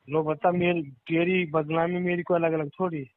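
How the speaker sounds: usual speaking voice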